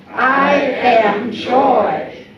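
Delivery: loud, slow chant; group affirmation. Shown as a demonstration. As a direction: call it out slowly together.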